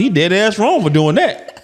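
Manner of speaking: slow laughing jab; mock commenter. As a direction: drawl it out with a laugh. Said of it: "somebody gonna be in the comment".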